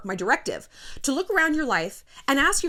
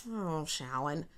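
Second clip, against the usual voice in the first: sympathetic sigh; 7 semitones lower, 12 dB quieter, much slower than usual. Pretend. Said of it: "you guys who love me are gonna be like".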